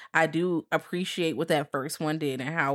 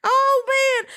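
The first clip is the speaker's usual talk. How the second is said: much higher, slow squeal of disgust; disgusted shriek; 4 dB louder, slower than usual. Retold or imitated. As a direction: squeak it out like a grossed-out girl. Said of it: "And she's like".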